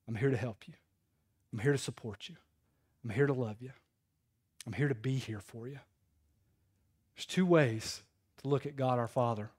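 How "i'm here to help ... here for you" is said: tender reassurance. Acted. God as dad to a struggling child, tender reassurance. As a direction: say it quietly, pausing between each promise. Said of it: "saying the exact same thing".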